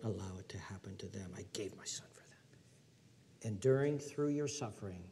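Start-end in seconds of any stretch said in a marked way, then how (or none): 0.0-2.6 hushed whisper of devotion; whispered tender vow. Pretend. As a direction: breathe it out softly. God's voice, hushed.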